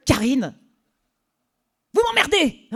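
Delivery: high, loud exasperated shout; exasperated yell. Retold or imitated. Boss exploding at his cheeky temp. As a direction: blow up at her.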